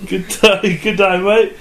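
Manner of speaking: put-on Australian-Jamaican accent. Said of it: "it's all Australian, Jamaican".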